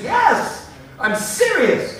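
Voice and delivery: a loud, high-flung yes, then slow and firm; fervent yes. Retold or imitated. Answering Denny whether he can be quoted, loud and insistent. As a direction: insist you mean it, no joking. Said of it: "Can you tell?".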